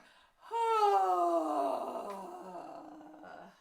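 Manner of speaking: loud, high, falling stretched yawn sound; big yawn. Shown as a demonstration. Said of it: "we'll do a big yawn".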